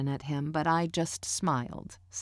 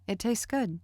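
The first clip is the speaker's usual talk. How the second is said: short, simple reply with higher pitch; said simply; 7 semitones higher than usual. A narrator's reading. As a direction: keep it simple and plain. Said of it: "I said simply".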